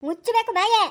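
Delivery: high, loud furious yell; angry yell. Acted. Stepmother screams at the crying girl to shut up, high and loud. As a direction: yell at her to shut her mouth.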